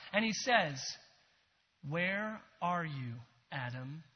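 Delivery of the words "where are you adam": soft questioning. Acted. God calls Adam, soft reading.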